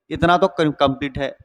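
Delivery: normal talking voice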